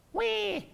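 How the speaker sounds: high thin mock baby wail; baby cry. Preacher imitates baby Moses crying, high and soft. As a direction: squeak out a newborn's cry. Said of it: "what does baby Moses do".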